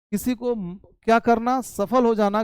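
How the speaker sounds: ordinary delivery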